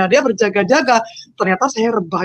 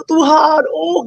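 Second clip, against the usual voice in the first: crying out; 6 semitones higher, slower than usual. Retold or imitated. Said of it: "gitu, nangis".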